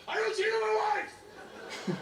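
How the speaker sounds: high, slow, laughing blurt; blurted declaration. Pretend. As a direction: announce it to the whole room. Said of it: "look her in the face in front of everyone and go".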